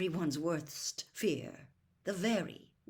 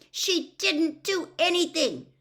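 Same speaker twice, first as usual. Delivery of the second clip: much higher, louder sharp retort; snapped; 11 semitones higher, 10 dB louder than usual. A narrator's reading. Hermione snapping at Percy over the elf, sharp. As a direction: snap back in her defence. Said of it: "Hermione snapped at Percy".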